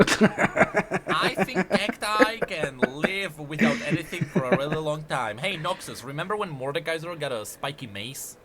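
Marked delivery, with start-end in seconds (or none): 0.0-5.4 laughing. Real, not acted. Laughing at cactus joke.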